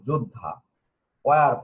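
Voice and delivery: normal talking voice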